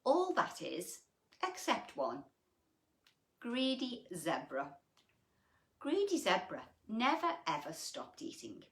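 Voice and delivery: ordinary delivery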